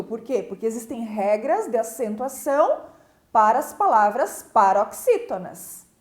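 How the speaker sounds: ordinary talk, nothing marked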